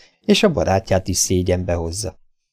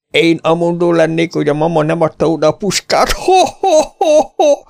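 higher, louder braying laughter; mock the boy and roar with laughter; jeering laugh; 10 semitones higher, 5 dB louder than usual. Davy jeers at the stranger, higher and louder laughter. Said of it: "szólalt meg újra Davy".

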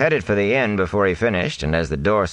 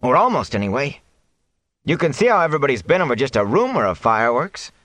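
put-on higher, complaining young voice; aggrieved young man; 10 semitones higher than usual. A narrator's reading. Matt complaining to the mayor, higher and aggrieved. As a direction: press the grievance.

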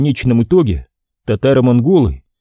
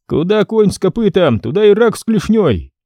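put-on young boyar voice; 8 semitones higher than usual. Ivan mocks Brenko.

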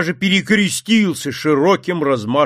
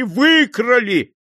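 slow, higher wail; drawn-out wail; 8 semitones higher, 3 dB louder, much slower than usual. Acted. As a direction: stretch the word out in despair. Frantic merchant's wife.

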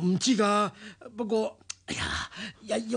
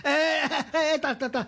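laughing; 6 semitones higher than usual. Acted. Robber gloating as he sits.

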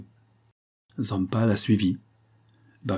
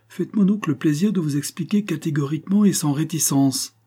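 higher-pitched, mildly exasperated demand; impatient countess; 7 semitones higher, 4 dB louder than usual. A narrator's reading. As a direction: press him impatiently for an explanation. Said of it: "impatientèrent la comtesse". (ton impatient, plus aigu)

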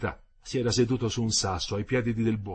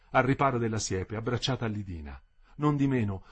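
ordinary delivery

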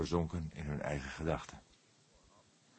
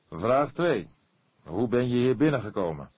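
louder, higher questioning; firm interrogation; 6 semitones higher, 7 dB louder than usual. A narrator's reading. The cook questions Erik, loud and firm. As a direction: press him firmly.

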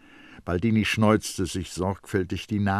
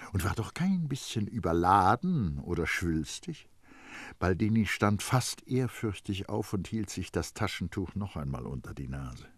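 ordinary delivery

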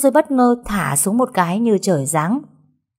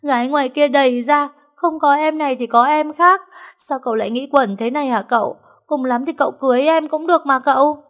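blubbering plea; 8 semitones higher, 4 dB louder than usual. Acted. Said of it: "nó khóc lóc đến méo cả mồm".

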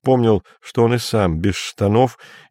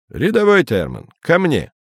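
raised call for the private; 7 semitones higher than usual. A narrator's reading. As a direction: call him over firmly. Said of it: "сказал полковник".

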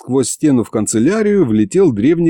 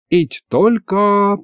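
hesitant doubt; 10 semitones higher, much slower than usual. A narrator's reading. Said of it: "почесал затылок Михалыч".

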